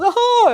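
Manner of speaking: high, loud pretend crying howl; mock wail. Preacher imitating someone crying along with you, mock wail. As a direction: burst into a hammed-up sympathetic wail.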